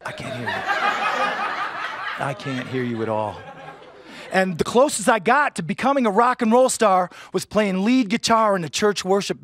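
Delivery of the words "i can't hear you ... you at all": put-on deaf old rocker. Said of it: "And now they say".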